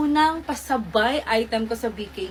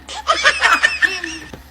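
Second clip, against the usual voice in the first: long, loud, high laughter; real helpless giggling fit; hearty laugh; 7 semitones higher, 6 dB louder than usual. Real, not acted. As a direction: crack up at yourself. Laughs at her own look.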